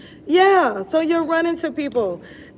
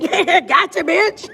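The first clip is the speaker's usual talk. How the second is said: louder, higher cheeky taunt; teasing gotcha; 5 semitones higher, 5 dB louder than usual. Retold or imitated. When strangers smile back at her, cheeky gotcha taunt. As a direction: gloat playfully, caught them. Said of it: "I'm like".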